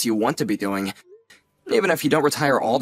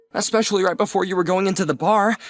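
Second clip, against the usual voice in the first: usual speaking voice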